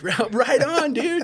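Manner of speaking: high, rising, half-laughing dude voice; stoked cheer. Retold or imitated. Guys running the wind tunnel cheering on a flyer, stoked. Retold cheer from the tube operators.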